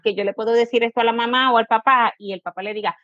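ordinary delivery

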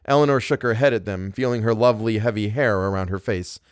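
plain reading voice

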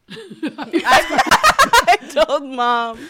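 hammed-up, slow copy of a viral line; mimicking the clip. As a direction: stretch it out high, laughing. Echoing the clip's line, laughing.

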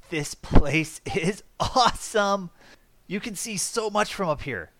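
delighted, high and loud; excited grin. Acted. Cat Mist gushing over the hideout, excited. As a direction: gush like a thrilled cat seeing the view. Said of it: "breaks into just the widest grin".

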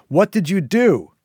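loud, high shout with DO falling hard; loud demand. A narrator's reading. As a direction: yell the question at her, stress on do. Syl repeats question, now loud.

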